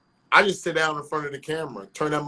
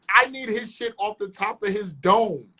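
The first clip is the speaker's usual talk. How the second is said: normal talking voice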